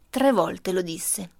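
ordinary delivery, nothing marked

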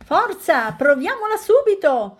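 loud, high cry of eagerness; excited shout. A narrator's reading. Piglet urging friends to try the cart, shouting eagerly. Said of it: "gridò il grasso Tonio".

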